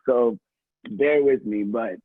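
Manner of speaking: ordinary talk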